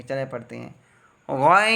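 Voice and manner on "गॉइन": "गॉइन" with a thick, rounded first vowel, drawn out; heavy letter. Shown as a demonstration. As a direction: recite "गॉइन" full-mouthed for the learner. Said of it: "इसको हम मोटा पढ़ेंगे". ("गॉइन" को मोटा करके पढ़ें)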